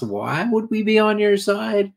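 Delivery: high, squeaky disbelieving question; mock indignant. Miners scoffing at Judo, high mock outrage. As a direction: play an offended miner scoffing.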